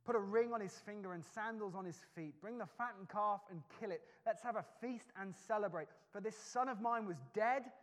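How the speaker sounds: the father ordering a party for his returned son; joyful proclamation. Acted. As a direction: call for a feast with delight. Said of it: "he responds by saying this".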